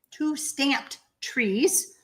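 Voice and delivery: drawn out, word by word; slow it right down, word by word. Shown as a demonstration. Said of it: "Let me say that a little bit slower".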